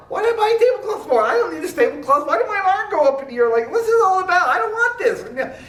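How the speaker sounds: fast, high, half-laughing complaint; exasperated rant. Acted. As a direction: rattle off the grumbling in disbelief. Grumbling to himself walking back.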